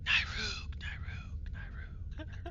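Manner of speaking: loud whisper carrying down a hallway. Acted. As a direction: whisper the name so it carries. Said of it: "almost like a hushed tone".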